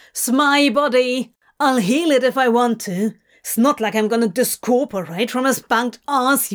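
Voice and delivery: loud, fast angry tirade; furious rant. Acted. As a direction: rail at him, furious and quick. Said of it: "Crowley shouted, furious".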